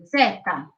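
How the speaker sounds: "zeta" said "DZEH-tah"; "zeta" as the Italian name of Z; Italian letter name. Shown as a demonstration. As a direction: say "zeta" in two syllables, stress first. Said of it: "So Z in Italian is".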